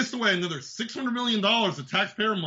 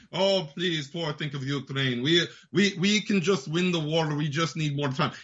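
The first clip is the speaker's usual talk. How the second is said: lower, pleading mock of a beggar; mock begging Zelensky; 6 semitones lower than usual. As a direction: whine it like a beggar asking for cash. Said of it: "He was gonna beg for money".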